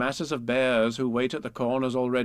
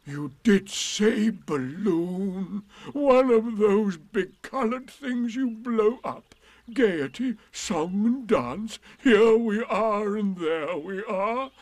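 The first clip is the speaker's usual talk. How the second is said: slow, raised donkey voice, ponderously delighted; Eeyore; 9 semitones higher, 5 dB louder, much slower than usual. A narrator's reading. Eeyore revels in gaiety, song and dance, slow delight. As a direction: savour each word, higher and drawn out.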